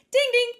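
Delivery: high, loud spoken ding-ding; imitated phone chime. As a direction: mimic a message alert. Imitates phone notification while dozing.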